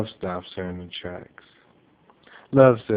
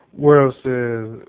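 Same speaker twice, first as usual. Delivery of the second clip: plain reading voice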